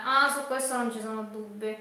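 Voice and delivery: ordinary talk, nothing marked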